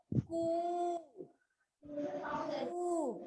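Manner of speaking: each "कु" drawn out; long vowel. Shown as a demonstration. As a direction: hold the u in every "कु" long. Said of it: "लंबा हुआ".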